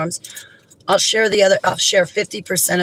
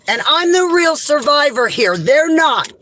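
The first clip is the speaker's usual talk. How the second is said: indignant; 8 semitones higher than usual. Real, not acted. Defending herself against online critics.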